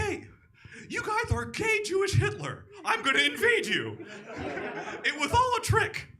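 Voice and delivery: hammed-up screaming dictator; Putin. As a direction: yell the threats in a shrill mock rage.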